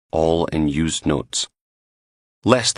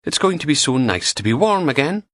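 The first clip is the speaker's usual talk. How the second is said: put-on much higher sing-song Fife accent; 9 semitones higher than usual. A narrator's reading. Val dreaming of warmth, higher Fife accent. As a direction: lilt it up and down in Fife. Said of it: "that sexy Fife accent of hers".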